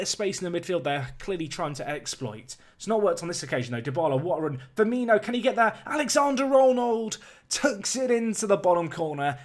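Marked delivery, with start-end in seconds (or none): 4.7-9.4 high, urgent commentary; raised voice, pitched high as the chance goes in; excited goal call. Real, not acted.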